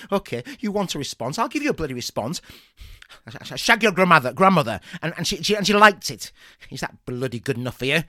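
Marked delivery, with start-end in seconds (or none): 1.4-8.0 fast, louder furious rant; angry outburst. Acted. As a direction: blurt it out in a fast rage. Goaded teacher outburst.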